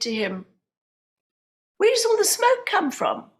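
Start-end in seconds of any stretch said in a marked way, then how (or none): 1.8-3.3 much higher, louder, alarmed; alarmed question. Retold or imitated. Wife asking her husband about the smoke, alarmed and high. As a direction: ask it startled and worried.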